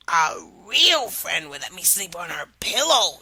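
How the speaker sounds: frog in a changed timbre; odd-toned frog. Acted. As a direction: ask as the cheeky frog. Frog voiced in story.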